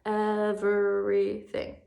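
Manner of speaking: EVERYTHING very slow, in four separate beats; syllable by syllable. Shown as a demonstration. Teacher counting syllables aloud for pupils, syllable by syllable. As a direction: draw EVERYTHING out as ev-er-y-thing. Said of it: "we're gonna count the syllables".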